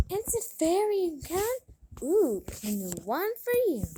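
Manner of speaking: high gasp then an excited, quick echo; spoiled daughter thrilled by a new flute; delighted gasp. Acted. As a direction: gasp with glee at the news.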